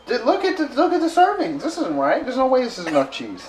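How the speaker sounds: indignant, loud and high; outraged. Real, not acted. Outrage over tiny cheese servings.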